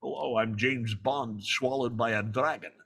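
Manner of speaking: low mock Bond impression; deep, parodic star impersonation; Sean Connery. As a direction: send up the suave Scottish spy. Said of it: "my idea for Connery doing Draco".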